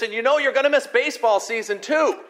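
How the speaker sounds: high, loud angry scolding; ranting and raving. Retold or imitated. Father scolding his hurt son, loud angry rant. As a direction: let the boy have it. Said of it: "ranting and raving at him".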